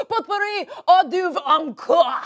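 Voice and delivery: bad French accent. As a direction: belt out fake French words grandly. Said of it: "bad French and Italian accents".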